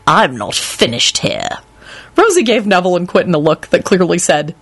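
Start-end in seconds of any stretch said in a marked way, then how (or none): 0.1-1.6 low, slow menacing warning; menacing growl. Acted. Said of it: "Lupin growled".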